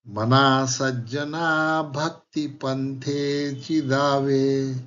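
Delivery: slow, measured verse recitation; shloka recitation. Acted. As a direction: draw out each syllable of the verse. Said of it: "त्यांचा मनाचा एक श्लोक आठवला".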